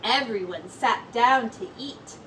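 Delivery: ordinary delivery, nothing marked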